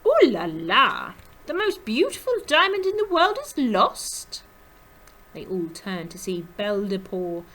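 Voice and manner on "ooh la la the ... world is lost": put-on loud, high, sing-song French accent; swooping French-accented dismay; musical French accent. A narrator's reading. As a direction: exclaim like a dramatic French lady. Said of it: "always sang in her musical French accent".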